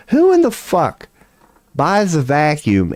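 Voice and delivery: high, disbelieving "who in the fuck"; incredulous outburst. Real, not acted. Streamer mocking his own vacuum buy, disbelieving squeal. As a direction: squeal the question in disbelief at yourself.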